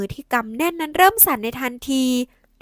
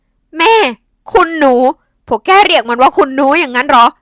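shocked disbelief; 9 dB louder than usual. A narrator's reading. Aunt stunned by the title.

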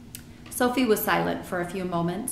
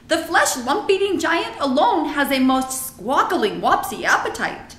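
friendly giant, emphatic and louder; BFG; 7 semitones higher, 6 dB louder than usual. A narrator's reading. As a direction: relish the odd words in a higher giant's voice.

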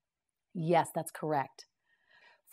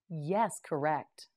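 usual speaking voice

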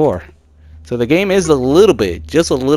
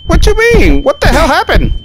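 high, loud disbelieving questions; shocked outburst; 9 dB louder than usual. Real, not acted. Player baffled by the game's overdose message, shocked outburst. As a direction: blurt it out, pitched way up and loud. Game suddenly says overdose.

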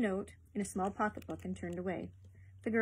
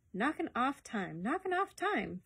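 "Knocking off time" drawn out slowly, higher on the repeat; slow, emphatic "knocking off time", rising in pitch the second time; 5 semitones higher, slower than usual. A narrator's reading. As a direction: hammer home "knocking off time", slowly, twice. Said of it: "Ferguson said with emphasis".

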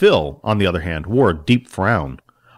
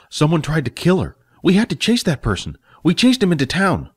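lightly higher, eager; a young helper reporting the chase; Jack explaining; 6 semitones higher than usual. Acted.

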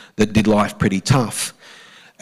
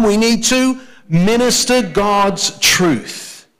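repeat together, slower; 8 semitones higher, much slower than usual. Shown as a demonstration. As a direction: drive the phrase home a second time, slowly. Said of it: "One more time".

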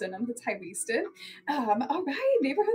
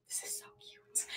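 softly whispered; 19 dB quieter than usual. Real, not acted. Admiring a leather jacket while selling live, whispered. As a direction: breathe it to yourself in awe.

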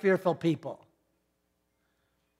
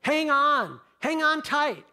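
loud, higher alarm call to the crew; retold shout; 5 semitones higher, 4 dB louder than usual. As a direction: call out the order over the storm, loud and urgent. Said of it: "someone yells out".

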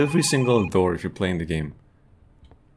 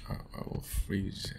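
slow whisper, barely voiced; 3 semitones lower, 14 dB quieter than usual. Real, not acted. Streamer shivering in the cold game scene, slow whisper. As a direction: breathe it out as if freezing.

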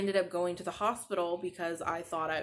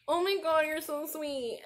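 high, choked-up thanks; moved almost to tears, voice lifting high; mother thanking her daughter, near tears; touched, tearing up; 8 semitones higher than usual. Retold or imitated. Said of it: "that made me tear up".

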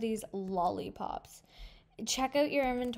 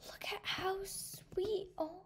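breathless whispered gasp, slow; awed whisper; 9 semitones higher, 4 dB quieter, slower than usual. Real, not acted. Girl whispering in awe at her panda. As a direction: whisper as if it might break. Whispering over the cute pet.

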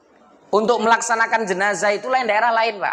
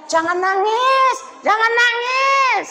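much higher, slow sing-song nagging; drawn-out fussy warning, half sung; busybody woman; 14 semitones higher, slower than usual. Retold or imitated. Mocking a woman telling the widow not to cry, high sing-song. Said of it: "Ada lagi yang bilang".

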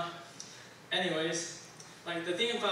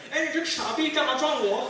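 much higher, loud furious yell; angry mom; 10 semitones higher, 8 dB louder than usual. Retold or imitated. Mom yelling at the stranger who bumped her, furious. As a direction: lay into the stranger like an angry auntie. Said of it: "She goes like".

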